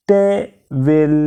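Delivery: টেবিল said slowly as te-bil; slow teaching demo of টেবিল; syllable by syllable. Shown as a demonstration. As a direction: split টেবিল into two slow beats. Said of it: "দুবারে উচ্চারিত হয়".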